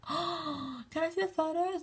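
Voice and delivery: high, softened mock gasp of awe; imitated fan excitement. Fan begging to see airport photos, high mock excitement. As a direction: plead high like an awestruck fan.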